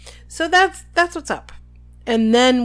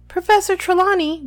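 indignant, much higher protest; hurt and indignant; 10 semitones higher, 4 dB louder than usual. A narrator's reading. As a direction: protest it, wounded and offended. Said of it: "in a hurt and indignant voice".

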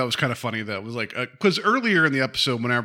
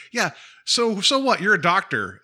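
high-pitched mock sibling jab; dismissive; 7 semitones higher, 4 dB louder than usual. Sisters bickering, mock dismissive. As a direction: brush it off in a raised, mocking voice.

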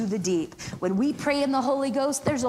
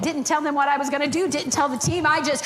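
ordinary talk, nothing marked

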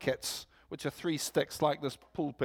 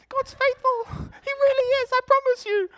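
very high, strained, growing loud; mock young preacher; 12 dB louder than usual. Young guy labouring to match the old preacher, high insistent mock voice. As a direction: push it harder and higher, trying to convince. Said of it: "This poor young guy spends forty minutes".

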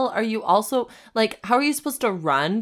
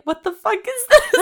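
laughing disbelief; 14 semitones higher, 5 dB louder than usual. Retold or imitated. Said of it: "he was like".

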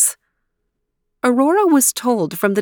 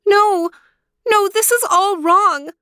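very high, louder outburst of refusal; distressed cry; 12 semitones higher, 4 dB louder than usual. A narrator's reading. Aurora refusing her aunts' marriage plan, crying out high. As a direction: cry it out in protest. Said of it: "cried Aurora".

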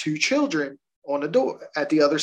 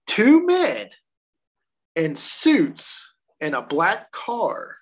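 dramatic reveal; 3 semitones higher, much slower than usual. Acted.

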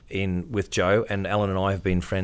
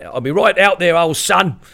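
calling out; 9 semitones higher, 9 dB louder than usual. Retold or imitated. Boxer calling from inside.

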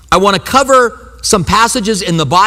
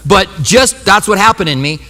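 usual speaking voice

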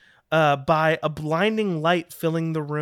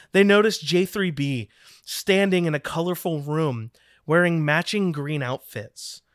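ordinary delivery

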